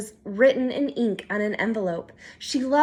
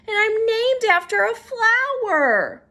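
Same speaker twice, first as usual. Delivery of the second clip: upset complaint; 9 semitones higher, 5 dB louder than usual. Acted. Upset child complains to parents.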